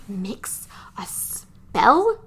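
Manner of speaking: very slow, half-whispered disbelief; drawn-out, whispery questioning echo; incredulous echo. Retold or imitated. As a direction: repeat it haltingly, as if it makes no sense. Incredulous echo of the odd line.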